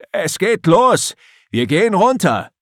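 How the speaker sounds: high, loud call across the deck; shouting. Acted. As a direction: call it out as he nears. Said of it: "ruft Erik erneut".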